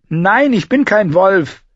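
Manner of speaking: high, loud, slow denial; desperate cry. Acted. Bran protests he is no wolf, crying out high and loud. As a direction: cry out against it in despair.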